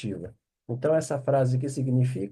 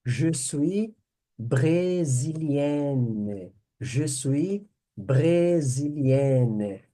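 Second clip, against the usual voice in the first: Je suis brésilienne slow, stretched ending; teacher modelling the feminine form; slow repetition; much slower than usual. Shown as a demonstration. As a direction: drill it slowly.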